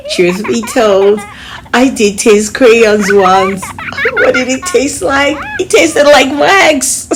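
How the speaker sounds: laughing through the confession. Real, not acted. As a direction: giggle while admitting it. Confessing eating crayons, laughing.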